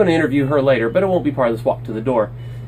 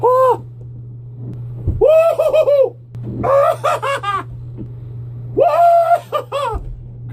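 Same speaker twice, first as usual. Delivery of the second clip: very high whooping cries with laughter; giddy outro celebration; excited whoop; much slower than usual. Real, not acted. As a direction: whoop it up joyfully.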